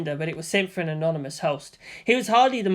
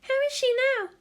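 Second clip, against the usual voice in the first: put-on much higher, gentle girl's apology; faster than usual. A narrator's reading. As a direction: say it tenderly to a hurt friend. Hikari asks about sister.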